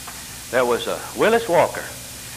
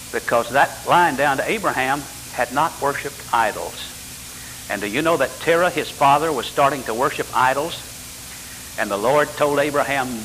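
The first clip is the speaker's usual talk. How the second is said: normal talking voice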